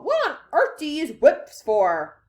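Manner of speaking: high, loud question; incredulous cry. A narrator's reading. Veruca questioning Wonka about whips, incredulous. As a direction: demand an explanation. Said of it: "cried Veruca Salt".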